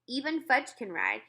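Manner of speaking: plain reading voice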